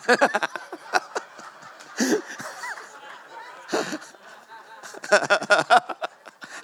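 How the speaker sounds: breathy high cackle of delight; high laughter. Real, not acted. Preacher cracking up while retelling the pilot.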